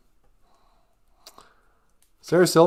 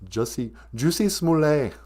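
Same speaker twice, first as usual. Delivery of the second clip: usual speaking voice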